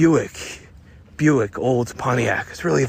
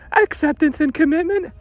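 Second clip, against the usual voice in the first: falsetto damsel; 5 dB louder than usual. Acted. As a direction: beg the detective in a high falsetto. Woman voice.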